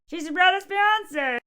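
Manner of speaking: mock chatty reply. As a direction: answer in a different voice. Other voice answers.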